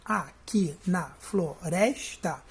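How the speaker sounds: "Aqui na floresta" slowly, a-qui-na-flo-res-ta in separate beats; syllable by syllable. Shown as a demonstration. Teacher scanning the first verse, "Aqui na floresta" split into syllables. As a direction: count out "Aqui na floresta" with a gap after each syllable. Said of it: "eu vou contar até o rés".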